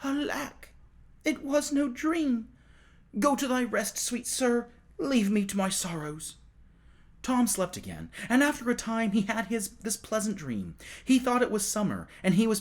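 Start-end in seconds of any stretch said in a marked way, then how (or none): 0.0-6.4 put-on high, plaintive boy's lament; plaintive murmur. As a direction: Tom into his pillows, high and plaintive. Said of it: "murmuring plaintively".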